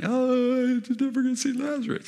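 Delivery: very high, hammed-up wail; mock weeping. Preacher showing how Jesus was not weeping over Lazarus, mock wail. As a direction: fake a high-pitched tearful lament. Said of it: "He's not weeping like".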